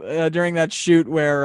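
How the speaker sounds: usual speaking voice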